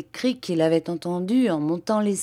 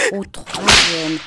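usual speaking voice

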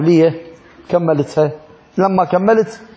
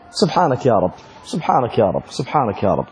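awed praise; 6 semitones lower, 4 dB quieter than usual. Real, not acted. Repeated awed praise, fading.

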